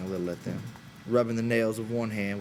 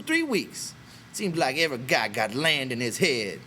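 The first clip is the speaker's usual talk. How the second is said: louder, harsh and scornful; brutal; 7 semitones higher, 3 dB louder than usual. A narrator's reading. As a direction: cut their dream down hard. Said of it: "Crooks interrupted brutally".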